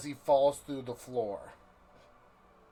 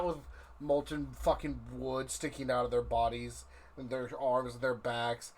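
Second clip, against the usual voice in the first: normal talking voice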